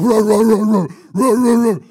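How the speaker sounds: very loud, rough rapid barks; full-volume attack-dog impression; imitated guard-dog barking. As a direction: bark ferociously like a dog at an intruder. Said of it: "I want".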